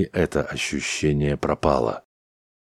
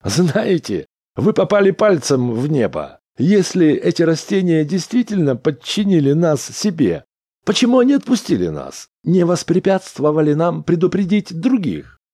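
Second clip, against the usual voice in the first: high, loud, mocking disbelief; derisive Smith; 13 semitones higher, 5 dB louder than usual. A narrator's reading. Smith refutes Chauns's theory after laughing, derisive. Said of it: "Смит засмеялся".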